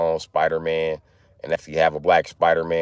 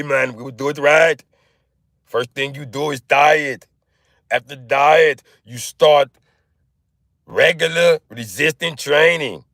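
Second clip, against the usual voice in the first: put-on accented muscle-man coach, climbing higher and louder; Arnold; 4 semitones higher, 3 dB louder than usual. Coach laying out a workout plan, Arnold impression. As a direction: lay out the steps like a bodybuilder, building in force. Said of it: "Arnold is in the room".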